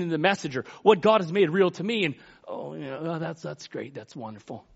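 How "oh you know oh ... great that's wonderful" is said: imitated half-hearted praise, very soft; lukewarm response. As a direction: murmur it back with feigned, low-energy approval.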